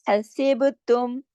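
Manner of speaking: हसीब तुम slow, with a bouncing qalqala on ब; slow Quranic reading of हसीब तुम; tajweed recitation. Shown as a demonstration. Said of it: "सिर्फ बा पे कलकला होगा".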